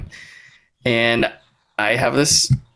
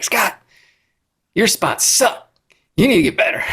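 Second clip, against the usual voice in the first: mock yell; 11 semitones higher than usual. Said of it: "And he is yelling at me".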